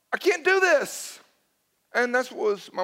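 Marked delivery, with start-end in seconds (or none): 0.1-1.2 panicked. Retold or imitated. Retold panic before first talk.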